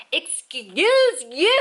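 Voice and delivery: loud, high, drawn-out indignation; really bitchy. Retold or imitated. Tired traveler calling out a rude stranger, really bitchy. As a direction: stretch it into an exaggerated bitchy challenge. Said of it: "like, really bitchy".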